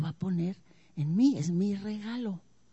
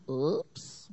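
sheepish oops; 8 semitones lower, 5 dB quieter than usual. Pretend.

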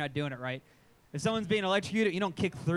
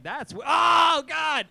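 loud, high yelp of pain and shock; shocked outburst; 9 semitones higher, 10 dB louder, much slower than usual. Retold or imitated. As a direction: cry out mid-sentence as the current hits. Reenacts getting shocked by the outlet.

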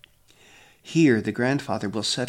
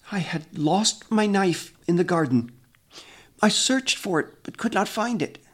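higher, thin voice of a frightened man; weak, frightened voice; 8 semitones higher than usual. A narrator's reading. Accused man confessing before the elder, weak and frightened. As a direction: plead it weakly, close to breaking. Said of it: "In a voice so weak".

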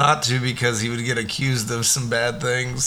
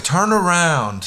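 cop command; 6 semitones higher, much slower than usual. Retold or imitated. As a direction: bark it like a police officer. Cop voiced in retold arrest.

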